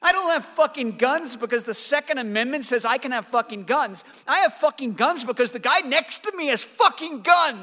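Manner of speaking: high, loud furious rant at the president; furious shout. Acted. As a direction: blow up, yelling every word. Peter yells at the president.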